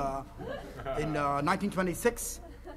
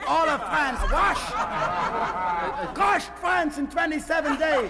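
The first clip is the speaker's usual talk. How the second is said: ranting German officer; 5 semitones higher than usual. Pretend. Fake planter slips into a general's boastful rant. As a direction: boast of conquests in a rising frenzy.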